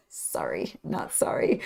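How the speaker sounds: hushed, half-whispered aside; soft whispered non-apology; mock apology. Real, not acted. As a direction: whisper it, cheekily unrepentant. Mock apology after her smut tangent.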